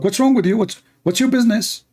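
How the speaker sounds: put-on high-pitched Saudi Arabic accent; sharp Saudi accent, irritated. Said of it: "in Saudi accent".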